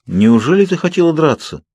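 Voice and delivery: can't believe she'd fight; puzzled. A narrator's reading. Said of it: "недоумевал Эдвард".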